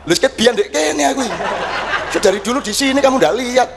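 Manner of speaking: mock-indignant God answering a seeker. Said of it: "Terus Tuhan datang".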